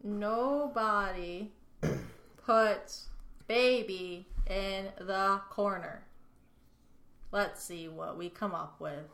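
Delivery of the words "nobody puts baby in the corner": word by word, with gaps; slow dictation. Real, not acted. Co-host looks up the movie quote, slow dictation. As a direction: slowly repeat it as if typing it. Repeats quote slowly while looking it up.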